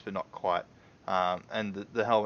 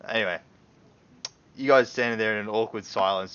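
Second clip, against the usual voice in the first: normal talking voice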